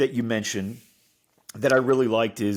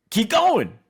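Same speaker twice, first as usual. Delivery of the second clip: loud, high-pitched push; coach urging; 10 semitones higher, 6 dB louder than usual. Shown as a demonstration. Coach to client stopping at eight push-ups, urging loudly. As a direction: drive the client on forcefully. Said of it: "do you say, like".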